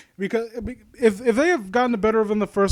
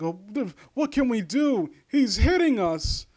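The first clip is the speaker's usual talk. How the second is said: higher-pitched whiny mockery of the cowering kids; mocking the bullies in a higher, whining voice; mock scared bullies; 7 semitones higher than usual. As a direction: whine it like a helpless coward. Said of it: "they're like".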